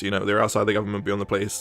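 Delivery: "outside the government, beyond the police" said low and soft, tossed off; mock police, muttered. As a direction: mumble it like a bored cop passing the job on. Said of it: "Yeah, they're like".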